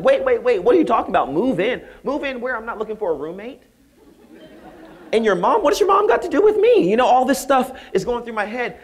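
loud, high-pitched comic alarm; bewildered outburst. Retold or imitated. Professor reacting to a baffling email, loud indignant bewilderment. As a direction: protest in hammed-up confusion, voice shooting up.

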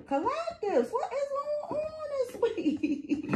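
very high, soft singsong squeal; teasing little high voice; playful high-pitched questioning. Acted. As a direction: ask it in a tiny falsetto, drawn out at the end.

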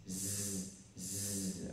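very soft voiced z; z buzzed softly to show voicing. Shown as a demonstration. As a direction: hum the z quietly. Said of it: "S nó sẽ được phát âm là z".

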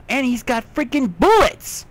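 loud, high thrilled yell; real excited shout over loot. Real, not acted. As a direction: yell it with delight. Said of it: "Sorry if I yelled".

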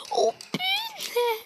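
high, drawn-out squeal; dismayed squeal. Real, not acted. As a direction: wail it out slowly, very high. Near miss in game.

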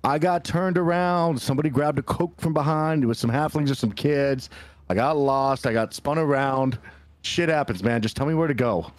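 exasperated lost-henchman act; defensive excuses. Acted. Player makes excuses in character.

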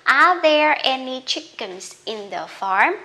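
slow careful reading, rising at the end; slow demo question; slow model sentence. Shown as a demonstration. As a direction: read it out slowly for learners. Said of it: "tới phần hội thoại".

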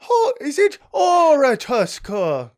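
hammed-up Irish accent, high and slow; mock Irish accent. Mocking himself for the Irish guest, over-the-top Irish accent. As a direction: play a cartoon Irish lilt on Oireachtas. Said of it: "if I say it with an Irish accent".

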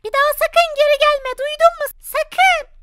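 high, steady protective voice; high spirit voice. Acted. Good spirit Selena confronting Hades, high voice.

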